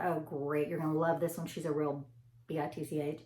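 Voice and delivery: low, hushed imitation of a coworker; confidential aside. Imitating nurse's snide aside about patient.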